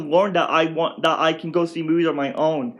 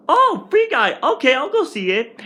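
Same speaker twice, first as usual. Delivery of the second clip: high, bright squeal on oh; excited discovery; 9 semitones higher than usual. Retold or imitated. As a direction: jump at the idea happily. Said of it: "and I was like".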